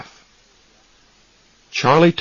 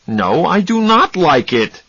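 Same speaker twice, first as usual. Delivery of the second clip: mild shout; 8 semitones higher, 3 dB louder than usual. Acted. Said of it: "he shouted".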